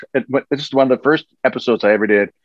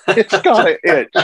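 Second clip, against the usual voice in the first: laughing repeat; 8 semitones higher, slower than usual. Real, not acted. Repeating the line, cracking up.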